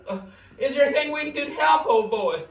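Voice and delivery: put-on high, loud fussing at the big old boy; hammed-up worry for the sidekick; mock concern. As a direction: coax him like a hurt pup. Fussing over the upset assistant.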